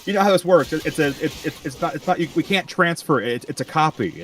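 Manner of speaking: high-pitched, stammering cover-up; a caught-out woman fumbling for excuses; flustered lady. Retold or imitated. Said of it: "the lady's like".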